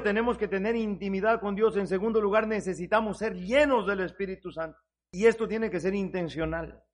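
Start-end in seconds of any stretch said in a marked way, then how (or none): none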